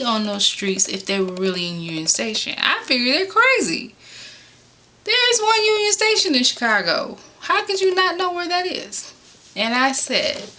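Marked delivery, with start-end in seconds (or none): 2.6-9.1 high-pitched can't-believe-it rant; exasperated disbelief. Real, not acted. Incredulous about the callers.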